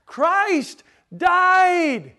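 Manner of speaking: CHRIST and DIED each punched high, loud and drawn out; emphatic exclamation. Shown as a demonstration. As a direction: proclaim "Christ died" like an exclamation point, both words ringing out. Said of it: "putting an exclamation point on the verse".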